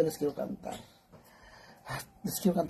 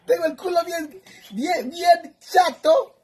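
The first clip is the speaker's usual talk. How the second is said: high-pitched shriek; 12 semitones higher, 7 dB louder, slower than usual. Real, not acted. Mocking her own flat backside.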